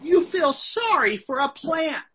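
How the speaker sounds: high-pitched voice of disbelief; incredulous. Retold or imitated. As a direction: point out the absurdity. God's absurd point, announced as funny.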